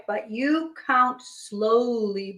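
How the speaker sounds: slow, stretched-out words; drawn-out request. Acted. Hippo asks Bear to count slowly, drawn-out. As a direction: stretch it out to make the point.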